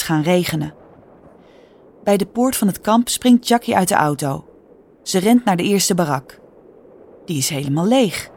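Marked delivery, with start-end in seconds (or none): none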